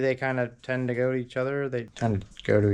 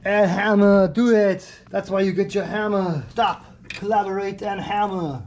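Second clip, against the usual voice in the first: excited hype; 7 semitones higher, 6 dB louder than usual. Real, not acted. Cheering on the air hammer.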